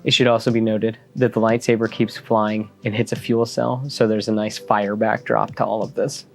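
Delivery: hushed, dramatic storytelling over music; story narration. A narrator's reading. Comic recap read as story.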